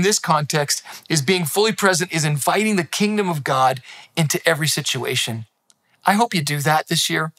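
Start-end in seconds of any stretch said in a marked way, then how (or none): none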